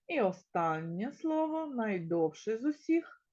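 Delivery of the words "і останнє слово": normal talking voice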